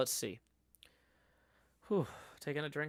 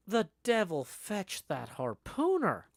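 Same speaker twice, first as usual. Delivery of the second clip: slow, higher-pitched muttered-thought curse, acted; exasperated curse; 6 semitones higher, slower than usual. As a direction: curse him with drawn-out theatrical annoyance. Narrator's exasperated curse in story.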